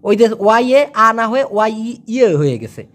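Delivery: ordinary talk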